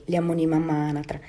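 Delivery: plain reading voice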